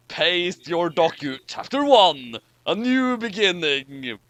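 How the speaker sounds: ordinary talk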